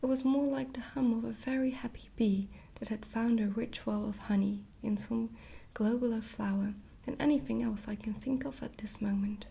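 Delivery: ordinary delivery, nothing marked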